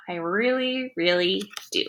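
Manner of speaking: soft, fond confession; tender. A narrator's reading. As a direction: say it softly with a fond heart.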